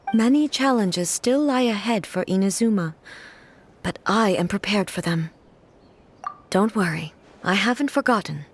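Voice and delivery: ordinary delivery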